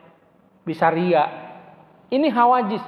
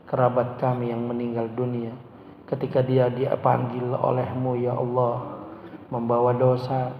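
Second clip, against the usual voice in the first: lower, calmer prayer voice with fading endings; earnest congregational supplication; leading a prayer; 8 semitones lower, 4 dB quieter than usual. Acted. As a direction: plead humbly on behalf of the congregation. Leads the closing prayer.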